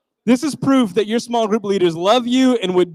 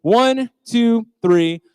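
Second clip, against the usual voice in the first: ordinary talk, nothing marked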